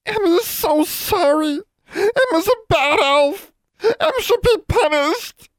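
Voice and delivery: very high, slow crying elf voice; wailing elf. A narrator's reading. Guilty house elf wails her apology to the custodian, very high and tearful. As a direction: sob it out, begging for punishment. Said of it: "the elf sniff and then wail".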